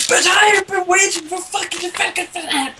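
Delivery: high, shouted retold outburst; imitating an irate caller shouting; angry rant. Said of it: "God, he went off on one".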